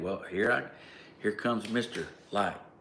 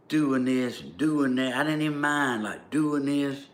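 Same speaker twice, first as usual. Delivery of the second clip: proud golden husband; 6 semitones higher, 4 dB louder than usual. Real, not acted. Recalling being the golden husband.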